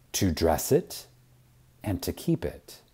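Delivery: ordinary talk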